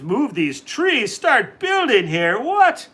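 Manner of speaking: slow disbelief building to a high loud yelp; outrage swelling into a squawk; rising rage. A narrator's reading. Leprechaun hears his trees will go, building to an outraged high yelp. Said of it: "in a purple rage".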